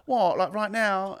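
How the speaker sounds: high, loud incredulous question; mock disbelief. Character reacts to the five-pound dare, mock disbelief. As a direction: squeal it in put-on surprise.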